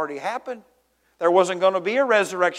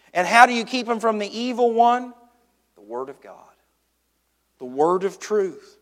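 ordinary delivery

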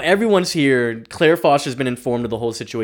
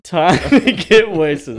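slow, louder, higher mock declaration; mock Lex; 5 semitones higher, 6 dB louder, slower than usual. Echoing Lex reaching for whiskey, slow hammed-up voice. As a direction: drag it out like a toast.

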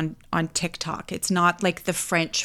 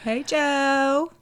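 playful call-out; 8 semitones higher than usual. Pretend. Calling greeting to absent English friend.

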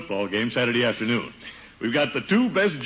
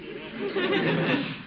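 high scoffing chuckle; mocking chuckle; 5 dB quieter than usual. Uncle scoffing at Brenda calling Leroy dreamy, high chuckle.

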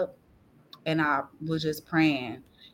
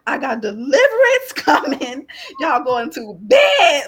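higher, louder, half-laughing order; telling the kids off; 7 semitones higher, 7 dB louder than usual. Retold or imitated. Mother telling her kids to leave her alone, raised voice. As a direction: shoo the kids away firmly. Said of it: "I was letting them know".

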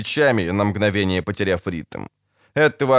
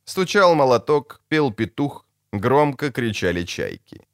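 usual speaking voice

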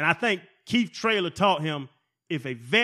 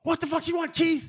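cussing; 11 semitones higher than usual. Retold or imitated. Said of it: "He cussed".

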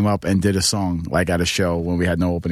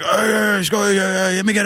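high mock shout of a drunk fan begging; 11 semitones higher than usual. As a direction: holler for a beer like a drunk giant. Drunk Scot demanding beer.

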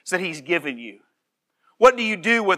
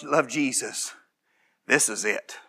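ordinary talk

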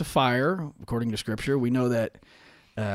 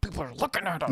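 whisper yelling; 5 semitones higher, 6 dB quieter, faster than usual. Retold or imitated. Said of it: "like you talk to your kids at the grocery store".

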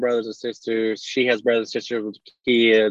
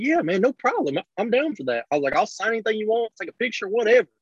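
higher, breezy retold reply; lightly voiced easygoing self, pitched up; mock easygoing self; 8 semitones higher than usual. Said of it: "I'm like".

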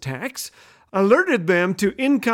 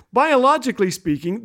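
ordinary delivery

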